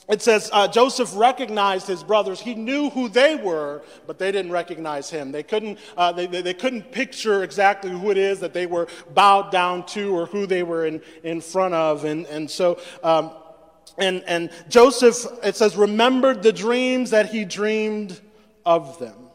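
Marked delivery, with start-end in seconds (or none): none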